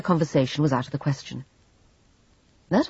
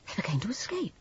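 whispering; 5 semitones higher, 9 dB quieter, faster than usual. A narrator's reading. Bitter inner thought.

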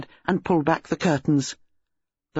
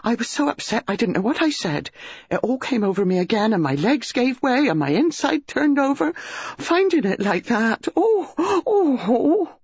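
rising, gasping, ever higher; gasping distress; 9 semitones higher than usual. A narrator's reading. Upset maid gasping out her shock to the master, building to wails. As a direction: build from shaky to high wailing oh's. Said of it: "Mary gasped out".

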